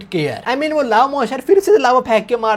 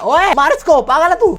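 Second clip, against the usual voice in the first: higher, mock shouted order; imitated yell; 7 semitones higher, 3 dB louder than usual. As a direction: bark the order like Lord yelling. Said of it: "Lord चिल्ला के बोलता है". (चिल्लाकर दिया गया नकली आदेश)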